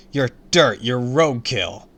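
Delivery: high loud taunts, slow at the end; loud insults. A narrator's reading. Hero keeps insulting villain.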